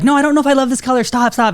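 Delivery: high, fast, flustered pleading; imitating flustered wife. Husband mimics wife stopping him painting, high and fast. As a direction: rush it out like a wife begging him to stop. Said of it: "she actually was like".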